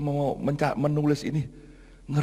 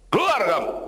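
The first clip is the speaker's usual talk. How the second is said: sharp raised-pitch mock yell; retold angry yell; 8 semitones higher, 4 dB louder than usual. Pastor throwing out the office boy, retold snapping shout. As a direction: act out a sudden scolding outburst. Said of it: "Langsung saya bentak".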